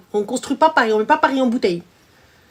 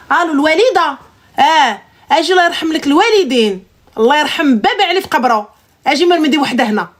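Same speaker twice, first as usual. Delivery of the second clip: fast, raised-pitch calling out; brash phone call; 3 semitones higher, much faster than usual. Shown as a demonstration. Wife phoning her mother for backup, brash and fast. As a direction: switch to rapid-fire summoning. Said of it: "Vous avez vu la différence?".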